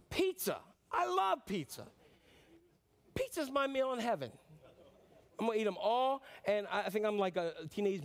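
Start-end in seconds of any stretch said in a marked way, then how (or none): 0.1-4.3 pizza said very high and delighted; gushing over pizza. Real, not acted. Preacher confessing his pizza love to the congregation, gushing high. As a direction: swoon over the word like a foodie.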